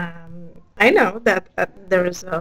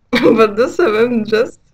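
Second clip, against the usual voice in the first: laughing; 4 dB louder than usual. Real, not acted.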